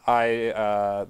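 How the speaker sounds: ordinary delivery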